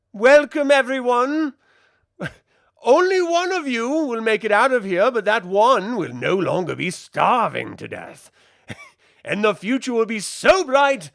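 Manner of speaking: high, loud, grand mock queen voice; a queen greeting doomed recruits, hammed-up and grand; mock Rhaenyra. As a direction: ham up a queen addressing the crowd. Rhaenyra's welcome speech, mocked.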